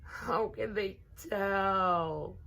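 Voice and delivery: slow, high, tearful question; through sobs. A narrator's reading. Sobbing girl asking her cousin about the fairies, tearful. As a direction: ask it between sobs. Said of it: "asked Rosa through sobs".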